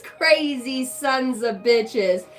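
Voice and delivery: slow, drawn-out heckle; hammed-up gawking at newcomers; mock amazement. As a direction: stretch it out in showy disbelief. Acted heckling of a group.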